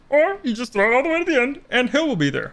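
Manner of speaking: high, fast froggy puppet voice; Kermit voice. Retold or imitated. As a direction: rattle it off like a frog puppet. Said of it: "Like, Kermit".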